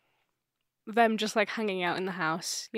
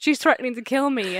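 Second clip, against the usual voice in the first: loud, higher hammed-up complaint; mock exasperated dad; 4 semitones higher, 7 dB louder than usual. Dad warns family about the ghost, mock exasperated. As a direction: plead your case in mock outrage. Dad's exasperation in acted quarrel.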